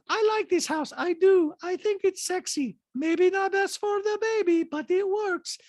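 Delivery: put-on very high-pitched accented caricature; squeaky falsetto mimicry of a reality star; Diana impression. Host mimics reality star in recap, high-pitched caricature. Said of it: "Diana says".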